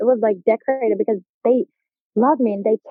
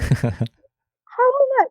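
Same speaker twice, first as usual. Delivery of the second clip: high, very slow, disbelieving; stunned squeak; much slower than usual. Retold or imitated. New homecoming queen asks in shocked disbelief. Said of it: "stunned reaction".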